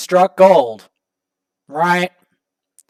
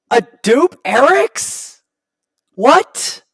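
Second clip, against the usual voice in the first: surprised; 6 semitones higher, slower than usual. Real, not acted. Surprised by the champion pulled.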